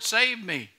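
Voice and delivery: normal talking voice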